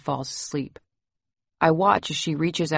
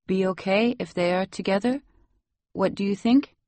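put-on higher voice with a Salvadoran accent; 4 semitones higher than usual. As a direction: ask gently in Salvadoran-accented English. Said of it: "You have such a nice accent".

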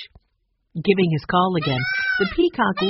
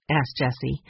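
ordinary delivery

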